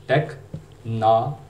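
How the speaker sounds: टेक्नोलॉजी slowly, syllable by syllable: tek-NAH-luh-jee; American pronunciation. Shown as a demonstration. Teacher spelling out the American sounds, slow tek-NAH-luh-jee for टेक्नोलॉजी. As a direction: break टेक्नोलॉजी up, open "nah". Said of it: "जो American pronunciation है".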